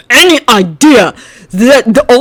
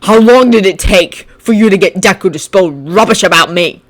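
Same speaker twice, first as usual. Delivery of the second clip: accusing question; 3 semitones higher, 8 dB louder than usual. Acted. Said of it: "Bakugo questioned".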